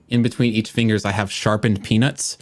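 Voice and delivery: ordinary delivery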